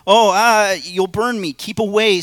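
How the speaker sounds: high, loud panicked cry; frightened man. Acted. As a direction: shrink back from the burning angel, high and loud. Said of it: "said the man, retreating".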